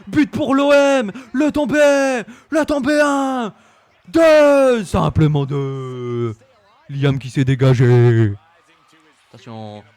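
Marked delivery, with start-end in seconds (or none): 0.1-4.8 high, loud football-style goal call; frantic pin count, high and loud; excited shouting. Real, not acted. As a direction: scream it like a stadium commentator.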